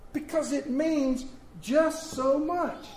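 soaring high and slow; lifted refrain. Acted.

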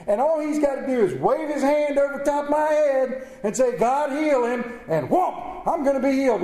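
loud, indignant rich man ranting; throwing a fit. Acted. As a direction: rant like a spoiled rich man denied. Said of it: "So Naaman throws a fit".

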